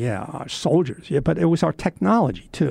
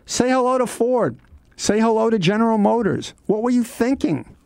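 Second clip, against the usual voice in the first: high, pitched-up mock yell at the prisoners; retold yelling; 9 semitones higher than usual. GI on the truck yelling at captured Germans, mock shout. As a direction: call out the defeated soldiers, scornfully. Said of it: "started yelling at the Germans".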